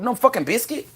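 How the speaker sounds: ordinary delivery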